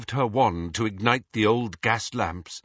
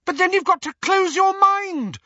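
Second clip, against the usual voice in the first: put-on very high, loud shrill girl's voice; shrill urgent insistence; 6 dB louder than usual. A narrator's reading. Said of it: "said Hermione shrilly".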